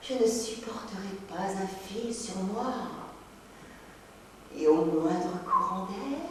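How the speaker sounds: soft, lingering daydream voice; quiet, theatrical swoon; mock-enraptured reverie. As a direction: sink dreamily into self-admiration, hushed. Mock reverie about adoring herself.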